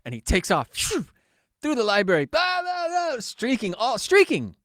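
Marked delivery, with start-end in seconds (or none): 0.7-1.1 high rushing whoosh noise; quick airy zoom; imitated whoosh. Imitates friend dashing off. 1.6-4.6 high, whooping retelling of a wild dash; gleeful mock commotion; hammed-up excitement. Acting out the naked dash.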